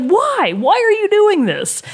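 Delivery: high, drawn-out mock despair; exasperated plea. Player to teammates who troll their own side, exasperated high plea.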